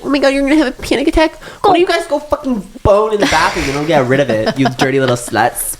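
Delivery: high mocking snooty voice; mock a shocked attendant up high; mock flight attendant. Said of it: "She's like".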